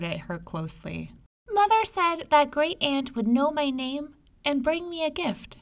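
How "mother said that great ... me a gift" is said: put-on higher child voice, checking; a small girl testing the visitor; little girl voice. May May tells the disguised tigress what her mother said, in a high child voice.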